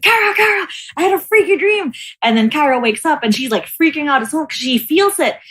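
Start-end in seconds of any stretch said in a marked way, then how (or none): 0.0-1.9 high, urgent call to a sleeping sister; frightened waking. Retold or imitated. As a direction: shake her awake in a panic, voice pitched up. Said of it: "So I wake Kara up".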